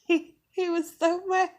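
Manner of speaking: gleeful retelling, giggles breaking in; laughing. Real, not acted.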